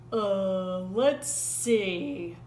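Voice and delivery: sleepy, drawn-out yawn. A narrator's reading. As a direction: speak through a yawn. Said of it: "Bear said with a yawn".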